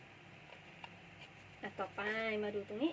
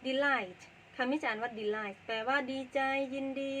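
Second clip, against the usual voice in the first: slow, careful explanation of delight; slow teaching read; slower than usual. Shown as a demonstration. Teacher slowly explaining delight to students. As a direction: spell it out slowly for the class.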